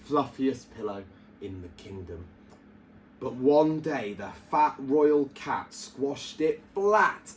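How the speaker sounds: ordinary delivery, nothing marked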